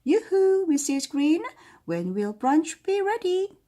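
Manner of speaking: high, loud sing-song call; princess calling out. Acted. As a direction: call across the castle like a spoiled princess. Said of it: "she called".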